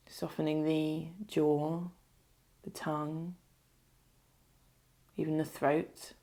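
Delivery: unhurried meditation voice, leaving space to breathe; guided meditation. Acted. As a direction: lead them gently into stillness, phrase by phrase.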